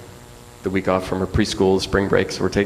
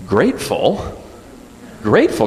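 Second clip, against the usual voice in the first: put-on puzzled child; 5 semitones higher, 4 dB louder, slower than usual. Daughter puzzled echo.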